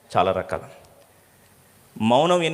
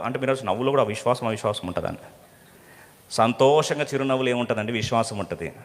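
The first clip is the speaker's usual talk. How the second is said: ordinary delivery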